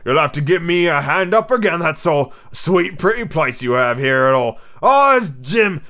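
loud, put-on pirate voice, rising to a hearty call; genial pirate. A narrator's reading. Pirate greeting Jim and company, hearty.

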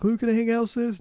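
put-on high, eager kid brother; little brother voice. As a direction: ask like a pleading little boy. Brother voiced in retold scene.

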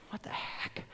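whispered irritated aside to himself; inner annoyed thought. Retold or imitated. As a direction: hiss the thought under your breath. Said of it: "I am starting to get angry inside".

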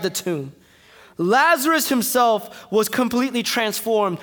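loud, rising preacher's cadence; full-force sermon climax; preaching. Real, not acted. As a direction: build it up from the pulpit.

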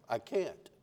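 very soft, meek refusal; tiny, hushed reply from hiding; timid voice. Acted. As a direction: barely dare to answer. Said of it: "this quiet little timid voice".

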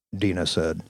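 ordinary talk

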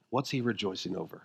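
low, soft, intimate; hushed sermon voice. Acted. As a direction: draw them in quietly. Hushed answer to rhetorical question.

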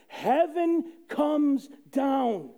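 proclaiming. Real, not acted. Preaching to the congregation, slow triumphant proclaim. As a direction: announce it triumphantly. Repeated line, hushed then proclaimed.

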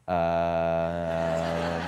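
low, drawn-out hesitant hum; reluctant hesitation. Retold or imitated. Sick woman unsure about a pastor's visit, reluctant. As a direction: stall on one long low note. Reluctant reply, asked a second time.

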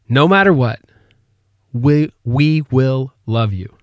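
slow, gentle promise; tender father. Retold or imitated. Father tells his worried son he is loved, slow and tender.

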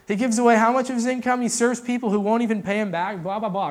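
loud, high incredulous critic; mock disbelief. Critics marvelling at someone giving income away, mock disbelief. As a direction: scoff loudly, imitating a baffled onlooker.

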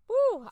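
high, loud whoop; loud exclaimed woo. Real, not acted. Speaker owning up to feeling insecure, high loud woo. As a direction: let out a sudden high "woo".

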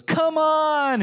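high, loud delighted shout; excited toddler call. Retold or imitated. Toddler calling to dad from the tile, high and excited. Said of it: "and she goes".

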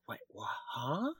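soft, stunned double take; baffled surprise. Retold or imitated. Actor retelling many offers after audition, baffled.

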